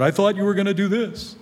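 high, whiny, let-down protest; disappointed complaint. Pretend. Disappointed believers complaining to God, whiny. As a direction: whine it like a let-down child.

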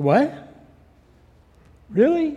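retold high, loud disbelief; shocked. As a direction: blurt it out as if shocked, imitating him. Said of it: "I think I shocked him".

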